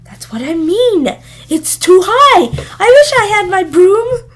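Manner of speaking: a witch who can't stand heights, pleading; scared witch cries out for her broom; panicky witch. A narrator's reading. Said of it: "I don't like it," muttered Pongwiffi".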